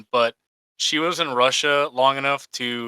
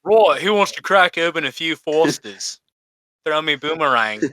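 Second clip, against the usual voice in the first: hammed-up Australian accent; put-on broad Australian accent. Shown as a demonstration. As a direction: play a stock Aussie inviting mates out. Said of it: "I can kinda do that Australian accent".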